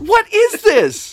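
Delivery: high, slow, incredulous question; stunned at what you're seeing; baffled. Retold or imitated.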